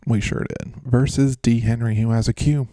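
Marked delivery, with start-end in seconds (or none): none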